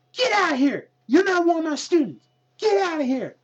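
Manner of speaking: high-pitched imitated trainer yelling; hammed-up trainer ordering someone out; mock angry trainer. Trainer shooing wrestler out.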